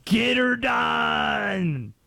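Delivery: Larry the Cable Guy. Retold or imitated. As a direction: bellow the catchphrase like a hammed-up country comic. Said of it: "Do Europeans know Larry the Cable Guy?".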